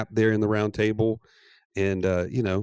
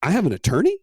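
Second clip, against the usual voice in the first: thrilled disbelief; 7 semitones higher, 5 dB louder, faster than usual. Retold or imitated. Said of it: "And she's like".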